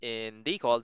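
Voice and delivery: ordinary delivery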